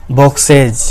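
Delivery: बॉक्सेज ending in a voiced "iz". Shown as a demonstration. Teacher showing the plural of box, ending voiced as iz. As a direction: say बॉक्सेज with a z/j sound at the end. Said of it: "जो के तरह आना चाहिए".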